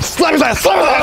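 high, loud urgent chant; play-fight shout. Pretend. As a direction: yell it as a goofy attack order.

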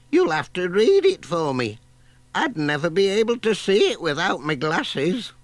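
put-on high, fluttery old lady voice; old granny voice. A narrator's reading. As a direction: play a dithery granny hunting her glasses.

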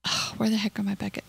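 usual speaking voice

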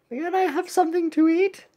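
high-pitched cartoon asker; high pleading character voice. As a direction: ask again in a squeaky little voice.

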